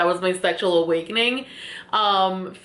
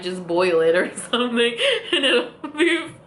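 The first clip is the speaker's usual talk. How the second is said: breaking into giggles mid-line, higher; giggling nervously while telling him it'll be fine; nervous laughter; 4 semitones higher than usual. Retold or imitated. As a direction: laugh through the reassurance. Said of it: "So I just kept laughing".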